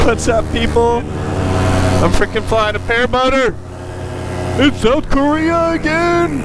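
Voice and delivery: high, elated call from the air; real excitement, stretched out at the end; excited calling out. Real, not acted. Calling out joyfully while flying.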